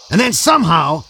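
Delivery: plain reading voice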